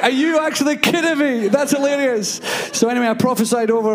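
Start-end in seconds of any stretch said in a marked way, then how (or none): 0.0-2.4 much higher, incredulous exclamation; high-pitched astonished outburst; retold amazement. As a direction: react in delighted disbelief. Amazed reaction to plug coincidence.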